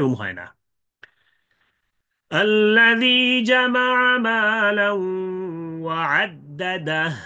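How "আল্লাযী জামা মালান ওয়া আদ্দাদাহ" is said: slow, high chanted tilawat; Quranic recitation. Shown as a demonstration. Teacher demonstrating recitation of the verse. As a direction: recite the verse drawn out and melodic. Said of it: "তো আমরা বলছিলাম".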